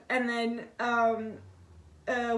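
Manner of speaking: ordinary delivery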